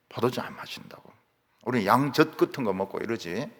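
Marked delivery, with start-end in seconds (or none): 0.1-1.1 soft unvoiced whisper; whispered firm refusal; hushed vow. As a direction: breathe the vow quietly. Voicing the Rechabites' vow.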